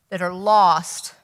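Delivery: usual speaking voice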